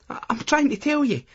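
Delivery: put-on a flustered girlfriend trying to get a word in; Lucy interrupting. A narrator's reading. Lucy voiced higher in story.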